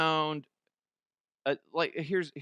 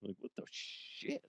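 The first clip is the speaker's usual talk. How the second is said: whispered aside; 4 semitones lower, 15 dB quieter than usual. Real, not acted. Baffled aside.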